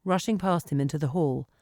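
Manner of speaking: ordinary delivery, nothing marked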